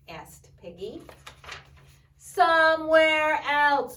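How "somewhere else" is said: slow, loud, drawn-out demand. Acted. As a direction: bellow it out slowly. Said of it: "Look at how loud he is".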